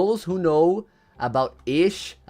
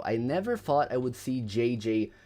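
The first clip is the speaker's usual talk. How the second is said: usual speaking voice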